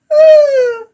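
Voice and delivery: high, crying-with-laughter burst; high disbelieving laugh. Real, not acted. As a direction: laugh until it almost breaks. Laughing at canceled order.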